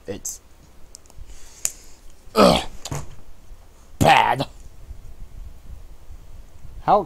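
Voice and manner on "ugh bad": loud grossed-out ugh, then bad; disgusted groan. Real, not acted. Describing the taste.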